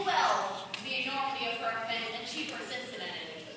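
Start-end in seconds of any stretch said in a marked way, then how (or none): 2.2-3.5 quiet. Acted. Repeated line, first soft.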